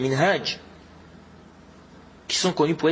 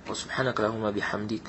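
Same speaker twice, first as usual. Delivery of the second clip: lower, softer recitation of the closing dua; closing prayer; 7 semitones lower, 8 dB quieter, faster than usual. Real, not acted. Preacher ending the sermon for his listeners, low soft prayer recitation.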